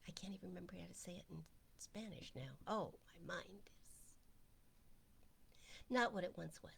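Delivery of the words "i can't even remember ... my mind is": whispered aside, fast. Real, not acted. Admitting forgetfulness to the group, in a whisper. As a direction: mutter it to yourself under your breath.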